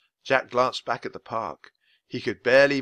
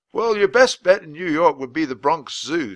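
a hint of a proper old-fashioned gentleman; polite official explaining; lightly voiced weather man explains the castle to children; 6 semitones higher, 8 dB louder than usual. Voice of Mister Perkins.

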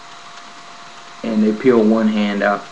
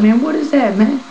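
high, alarmed question; 14 semitones higher than usual. Retold or imitated. To the doctor with the needle, alarmed.

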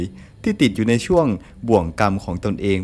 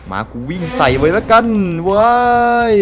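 reckless yell; 8 semitones higher, 6 dB louder than usual. Real, not acted. Deciding to run past monster.

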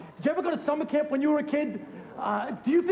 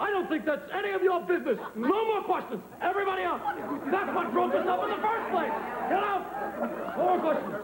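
high-pitched angry dismissal; worked-up, high-voiced indignation; indignant outburst; 8 semitones higher than usual. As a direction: throw them out in a huff. Throws the press out, offended.

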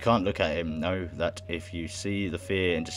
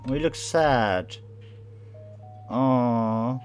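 high, drawn-out sympathy; drawn-out, higher-pitched pity; pitying aww; 6 semitones higher, 4 dB louder, much slower than usual. Real, not acted. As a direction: coo slowly and loudly with pity. Pitying a sad game character.

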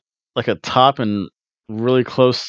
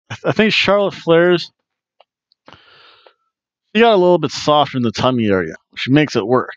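ordinary delivery

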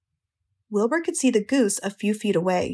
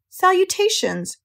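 slow, much higher sing-out greeting; thin voice, repeating; 10 semitones higher than usual. A narrator's reading. Unseen friend repeating the greeting, thin and very high. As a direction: repeat it drawn out, pitched right up. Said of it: "repeated the voice".

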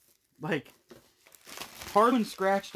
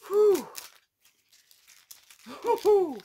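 whew; 12 semitones higher, 4 dB louder than usual. Real, not acted. Shiny card pulled, reacting.

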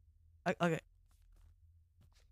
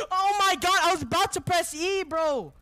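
loud, high wailing protest; panicked protest; 9 semitones higher, 6 dB louder than usual. Real, not acted. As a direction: cry out in frustrated panic. Panicked outburst after being caught.

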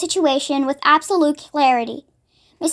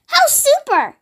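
high, delighted exclamation; excited cry; 11 semitones higher, slower than usual. A narrator's reading. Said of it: "Matilda cried".

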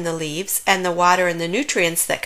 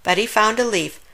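ordinary delivery

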